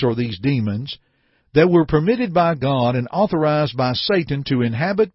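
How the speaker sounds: ordinary talk, nothing marked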